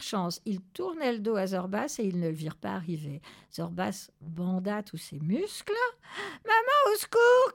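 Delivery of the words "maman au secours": very high, loud squeal for help; panicked chick's cry. A narrator's reading. Chick calls mother for help, panicked scream. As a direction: shriek it like a terrified baby bird. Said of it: "cria le poussin".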